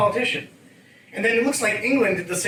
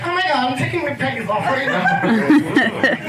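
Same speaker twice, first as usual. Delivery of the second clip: high, slow swoon; swooning girl; 7 semitones higher, slower than usual. Retold or imitated. Comic higher swooning American girl. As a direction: gush like a smitten girl. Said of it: "girls would just be like".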